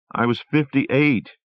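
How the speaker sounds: a lightly voiced wife, still shaken; Rosemary voice.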